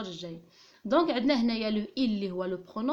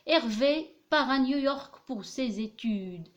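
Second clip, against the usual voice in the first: slow dictation reading; 4 semitones higher, 4 dB louder, much slower than usual. Shown as a demonstration. Dictating the example sentence.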